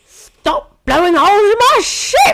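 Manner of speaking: high, loud indignant pirate yell; Jack Sparrow impression. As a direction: shriek it like an outraged old Englishman. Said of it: "he sounds like that".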